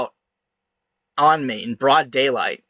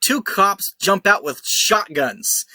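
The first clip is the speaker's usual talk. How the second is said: normal talking voice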